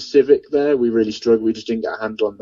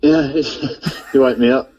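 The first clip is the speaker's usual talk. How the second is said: laughing; 4 semitones higher, slower than usual. Real, not acted. Laughing at the loud phone greeting.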